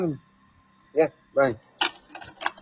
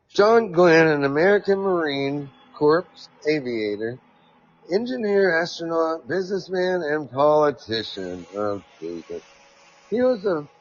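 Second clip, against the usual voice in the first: article read-aloud; 5 semitones higher than usual. Acted. Host reads a quote about Mars to listeners, raised reading voice. Reading John Glenn bio.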